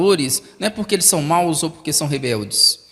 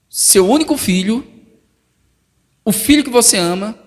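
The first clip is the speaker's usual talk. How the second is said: raised, louder, insistent; God pressing hard; 5 semitones higher, 5 dB louder than usual. Acted. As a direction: press the words home. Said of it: "Deus está realmente pressionando".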